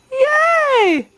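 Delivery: high bright yay; excited cheer. Real, not acted. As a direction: cheer with delight.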